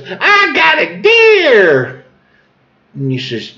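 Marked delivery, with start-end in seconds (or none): 0.1-2.0 high, slow squealed announcement; hammed-up high-pitched exclamation; imitating her excitement. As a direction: draw it out in a proud squeal. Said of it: "She goes".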